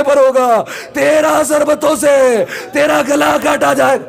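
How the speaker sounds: high, drawn-out mourning cry; wailing lament. Acted. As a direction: cry it out slowly, sustained high, building to a shout. Preacher wails Ali's lament for Husain.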